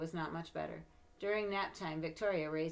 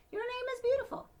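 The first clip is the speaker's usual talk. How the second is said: high, louder fond gush; doting praise; 8 dB louder than usual. Acted. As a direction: reassure your little girl. Father reassuring.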